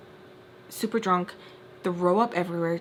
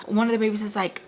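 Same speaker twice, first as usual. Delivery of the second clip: usual speaking voice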